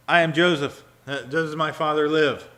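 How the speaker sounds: reading the scripture out with full force; loud proclamation. Acted. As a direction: proclaim it to the room. Reading Joseph's reveal.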